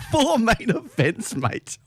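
usual speaking voice

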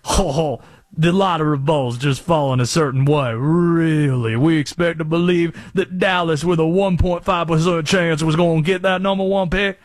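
a conspiracy caller with a put-on Southern accent, ranting; Conspiracy Josh Southern accent. Radio host in his Conspiracy Josh bit, theatrical Southern accent. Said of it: "Where did this accent come from".

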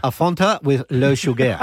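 hammed-up French with "sugar" as "shu-gair"; badly faked French drink order; mock French accent. Co-host showing how he'd order, mock French accent. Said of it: "That's how I'll do it".

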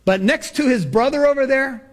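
high, slow funeral-eulogy declamation; pastor addressing the crowd. Acted. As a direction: draw out each accusation in a high pastor's voice. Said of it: "the pastor began to address the crowd".

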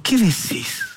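a high, lightly voiced tearful mother. Mother in tears asks her son what he means, mildly tearful. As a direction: ask it through tears, stunned. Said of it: "la mamá entre lágrimas le dijo".

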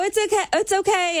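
high, light reassurance; high-pitched it's okay, it's okay; reassuring. Retold or imitated. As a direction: soothe him quickly in a high voice. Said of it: "but I go".

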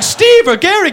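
calm friend calling. Acted. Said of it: "I'll be like".

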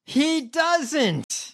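high-pitched contradicting call; mock heckle. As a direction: heckle the speech from afar. Heckling the played speech.

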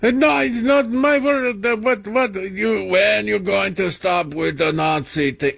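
put-on high-pitched, feminized Schwarzenegger-style accent; put-on Austrian-tinged accent, sputtering in protest; mocking Sri Thanadar accent. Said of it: "a feminized Arnold Schwarzenegger".

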